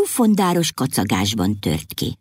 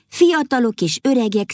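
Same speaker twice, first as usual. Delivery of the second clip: ordinary delivery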